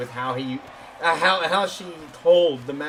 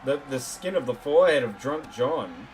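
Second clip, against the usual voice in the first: wrestling commentator; 4 semitones lower, 4 dB quieter than usual. Acted.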